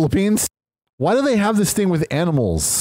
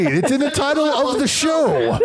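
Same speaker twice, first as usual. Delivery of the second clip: shouting; 11 semitones higher than usual. Real, not acted. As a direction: shout it as playful outrage. Joking protest at co-hosts.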